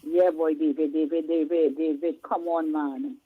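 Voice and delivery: ordinary talk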